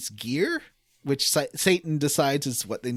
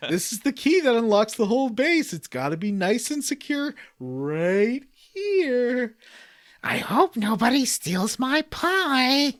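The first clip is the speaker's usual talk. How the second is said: slow, higher, hammed-up dim guard; dumb guard voice; 5 semitones higher, slower than usual. Mocking the game's guards, he hams up a slow, dim voice. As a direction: play a clueless guard, proud of his hiding place. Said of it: "the two guards ... are like".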